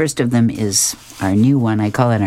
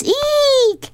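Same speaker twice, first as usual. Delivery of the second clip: very high-pitched cartoon "eek" shriek; hammed-up frightened squeal; mock scream. A narrator's reading. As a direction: squeal it far up high at normal volume. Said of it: "And Honey screams".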